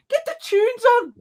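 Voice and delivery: very high, loud, stretched Scouse yell; mock Scouse shout. Guy at the back during police chase, mock Scouse shout. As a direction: imitate a Scouser yelling from the back. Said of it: "one guy at the back going".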